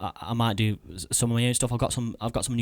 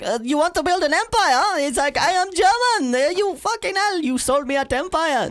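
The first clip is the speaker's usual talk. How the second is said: high, far-off mock German accent, slowed; squeaky German accent impression; Mark Corbyn impression; 5 dB louder, slower than usual. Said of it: "that's my Mark Corbyn impression".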